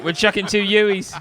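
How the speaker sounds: mock Australian accent. Boasting about a double U-turn, put-on Australian accent. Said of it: "the authentic accent".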